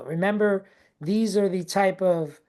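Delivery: ordinary talk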